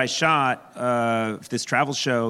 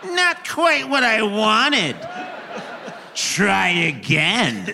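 laughing mock complaint; 7 semitones higher, much slower than usual. Mock customer returning shoes.

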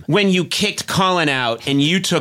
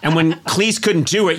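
usual speaking voice

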